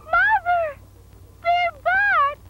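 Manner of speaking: high excited squeal. Acted.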